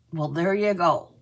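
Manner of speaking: ordinary talk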